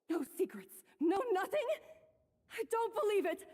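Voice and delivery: indignant shocked cry. Acted. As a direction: shout your disbelief. Shocked disbelief, outburst.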